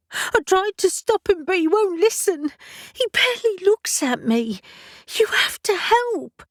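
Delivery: high, strained plea on the edge of tears; tearful plea. A narrator's reading. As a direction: beg for help while fighting back tears. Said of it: "blinking back tears".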